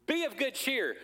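"Be of good cheer" sung out high and bright like a Christmas greeting; festive cheer. Shown as a demonstration. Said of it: "Doesn't that sound like Christmas?".

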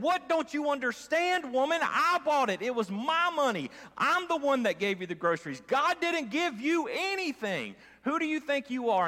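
a touch of hammed-up frustration; frustrated neighbor. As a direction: neighbor scolding the praying woman, mild mock anger. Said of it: "that neighbor's getting angry. He's frustrated".

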